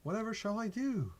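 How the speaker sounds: high, hammed-up damsel in distress; mock dismay. Opponent reacting to a played Royal Guard, mock dismay. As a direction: gasp in exaggerated horror, pitch way up.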